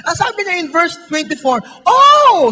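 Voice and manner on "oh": high, loud cry of Oh!; Oh! cried out high and loud; loud exclaimed oh. Shown as a demonstration. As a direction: throw out a big Oh!. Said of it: "Sabi natin, "Oh!".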